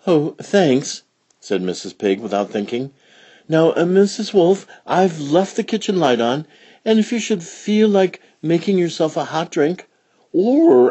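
high, bright motherly voice; Mother Pig. Acted. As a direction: lift the pitch for the cheerful mother pig. Mother Pig talks to babysitter.

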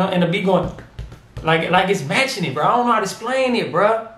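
ordinary talk

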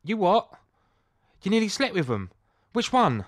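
loud, high incredulous questions; mock disbelief, pitched right up; shocked friend. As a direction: imitate a scandalised friend. Overheard shocked friend, acted.

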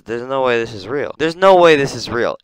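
usual speaking voice

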